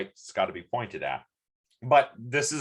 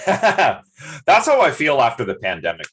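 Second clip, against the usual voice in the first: laughing; 9 dB louder than usual. Real, not acted. Laughing remark.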